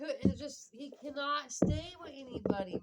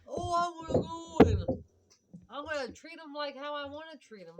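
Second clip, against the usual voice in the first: higher, loud-starting mock whine; mocking imitation; 5 semitones higher, 7 dB louder than usual. Mother mimicking how he treats women, loudly mocking. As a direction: imitate him with scornful exaggeration.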